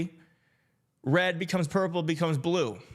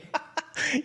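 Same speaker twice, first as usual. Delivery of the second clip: a bright high giggle; real delighted giggle; 9 semitones higher than usual. Real, not acted. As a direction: giggle at how fast they are. Laughing at fast chat.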